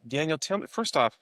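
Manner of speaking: ordinary talk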